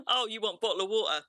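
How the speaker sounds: hammed-up British regional accent, loud and high; broad British accent. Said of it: "not that kind of British".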